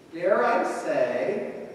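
ordinary talk, nothing marked

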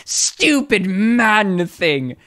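high, drawn-out mock wail; mimicking the clip. Streamer parroting a favorite clip to viewers, hammed-up wail. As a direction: imitate an overwrought cry, slow and high-pitched.

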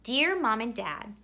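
ordinary delivery

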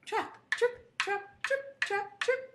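high, short rhythmic hoof beats; hoof sounds. Acted. Storyteller making little goat's hoofs go, high. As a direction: chant each step high and crisp. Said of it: "the Billy Goats went trip".

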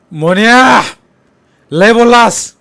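loud, high, harsh-edged threat; furious shout. Acted. Sonya warning Monya he has said too much, furious shout. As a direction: bellow the warning, done talking.

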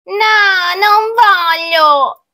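put-on high, loud, drawn-out child's refusal. Child refusing the witch's soup and spells, high wailed protest. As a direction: whine it high, stretching the vowels.

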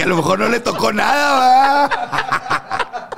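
cita entre risas, aguda; riendo. Retold or imitated. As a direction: dilo riéndote, en tono burlón. (cita irónica entre risas)

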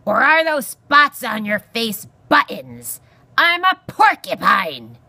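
loud, slow, outraged porcupine; screech. A narrator's reading. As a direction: screech each word out indignantly. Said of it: "Aerith screeched".

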